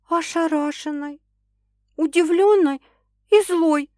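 high-pitched woman listing her feelings; frank admission. A narrator's reading. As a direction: admit it pointedly, word by word. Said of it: "призналась девушка".

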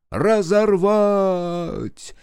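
high, long-held chanted cry; crowd thunder. A narrator's reading. Crowd demands the false Yasha, high chanted cry. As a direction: roar it back as a crowd, drawn out. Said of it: "Громыхнула толпа".

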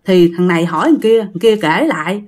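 ordinary talk, nothing marked

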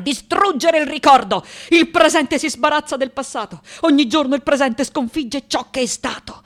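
high, driving declaration; fierce resolve. Acted. Sculptor vowing to destroy memory, fierce resolve. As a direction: push each phrase out high and forceful.

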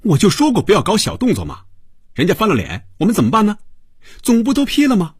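agitated, very high-pitched rant; exasperated high yelling; shouting complaint. A narrator's reading. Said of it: "冲着于威嚷道".

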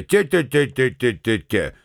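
a drawn-out mocking te-te-te, lightly gloating; drawn out, triumphant. A narrator's reading. Lambert teasing the angry Arkady, drawn out and gloating. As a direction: stretch it with a mild triumphant chuckle. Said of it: "протянул Ламберт, смеясь и торжествуя".

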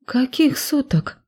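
high, slow confused question; baffled, drawn out; bewildered. A narrator's reading. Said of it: "растерялась я".

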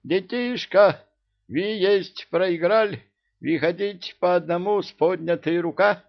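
loud, very high proclamation; thunderous announcement. A narrator's reading. Cat Murzik ordering children to surrender, booming. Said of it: "Громовые раскаты Мурзика".

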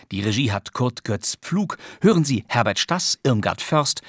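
ordinary talk

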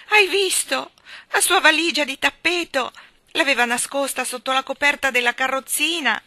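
loud, high breathless discovery; alarmed exclamation. A narrator's reading. Jane alarmed, pointing out the hidden carpet bag. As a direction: blurt out the discovery.

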